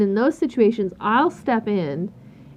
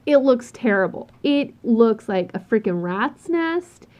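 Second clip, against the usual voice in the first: normal talking voice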